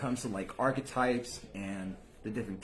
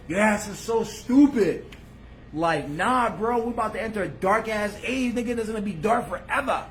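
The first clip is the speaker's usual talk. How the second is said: louder, higher mock of the gloomy crowd; mock doomsayer; 7 semitones higher, 7 dB louder than usual. Mimicking the other side predicting a dark age, loud and mocking. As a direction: ham up the doom prophets.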